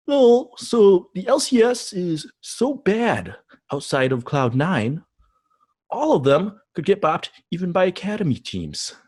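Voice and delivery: put-on high, slow, snooty European accent; obnoxious European accent. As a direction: drawl it in a smug European accent. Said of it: "obnoxious European accent for this one".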